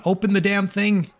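high, exasperated shout at the game; frustrated yelling. Real, not acted. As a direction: yell at the stuck door.

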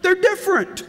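delighted woman. Retold or imitated. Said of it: "She said".

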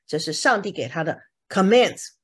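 ordinary talk

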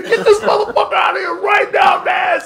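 high, very loud acted shout; outraged yell. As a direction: yell it like ordering security to eject someone. Repeats [name]'s outraged order.

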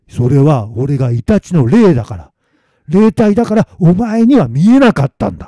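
loud, insistent spirit voice, rising; stern weasel spirit. Acted. Weasel spirit explaining it is invisible, indignant. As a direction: explain forcefully as an offended spirit.

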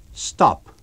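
a loud sudden order to halt; sharp command. Acted. The invisible man halts Eddie at the gate, loud. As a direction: bark it out.

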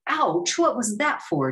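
sharp ouch, high and loud; pained demand. A narrator's reading. Damien bitten by the goose, yelping. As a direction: yelp then demand why. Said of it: "Damien demanded".

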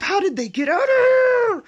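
high, loud incredulous shout; baffled yell. Real, not acted. To viewers, shouting in disbelief about the escaped quails. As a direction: throw up your hands and shout it.

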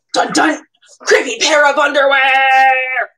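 loud, hammed-up horror announcement; dramatic horror title. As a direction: announce it like a scary movie. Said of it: "dramatic title announcement".

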